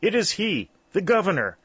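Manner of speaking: high, loud awed exclamation; old aunt crying out the governor's name, high and loud; exclaiming. A narrator's reading. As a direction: proclaim who he is, pitched high and loud. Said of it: "she exclaimed".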